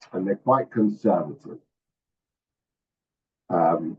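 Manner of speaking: usual speaking voice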